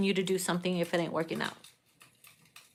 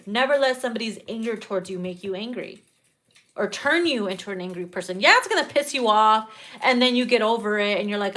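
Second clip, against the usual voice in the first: normal talking voice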